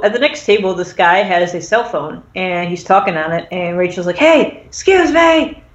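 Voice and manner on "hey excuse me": high, drawn-out hail to a stranger; mock calling out. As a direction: pester someone for attention, hammed up. Rachel hails man at next table.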